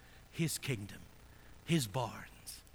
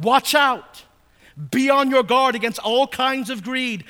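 loud, ringing pulpit voice, pitched higher; full-force preaching; 5 semitones higher, 11 dB louder than usual. Acted. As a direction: preach it out to the whole congregation. Preacher reads Gospel at full force.

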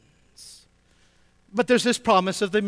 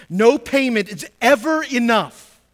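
louder and slower, leaning on EVER; emphatic preaching; 5 semitones higher, 7 dB louder than usual. Acted. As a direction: preach "ever" hard and slow, rising high. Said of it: "I'm gonna say it again".

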